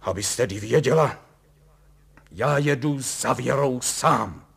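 loud, resolute announcement; determined. A narrator's reading. Husband announcing he will go alone, determined. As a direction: declare it forcefully. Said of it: "řekl Kalous odhodlaně".

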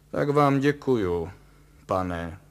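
loud, frosty thanks; icy, forceful politeness. A narrator's reading. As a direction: thank him coldly, with a pause before the last word. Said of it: "řekl Kalous mrazivě".

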